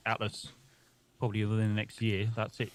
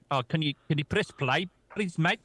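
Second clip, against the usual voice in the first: South African accent; 6 semitones higher than usual. Shown as a demonstration. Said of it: "Something like that".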